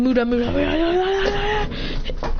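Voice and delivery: rapid-fire cry, rising as it goes; barrage battle cry. Acted. Storyteller imitates punch barrage, rising battle chant.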